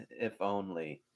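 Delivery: a dry, quiet aside; muttered aside. Retold or imitated. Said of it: "so hilarious and so quotable".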